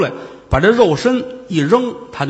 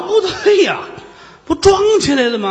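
startled realization; 11 semitones higher than usual. Acted. Old man seeing the monk back, startled high blurt. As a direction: pitch jumps high in alarm.

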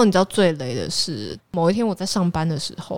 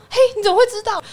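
startled; 5 dB louder, much faster than usual. Retold or imitated. Ex caught faking illness, startled.

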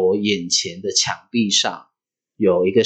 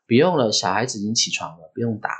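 usual speaking voice

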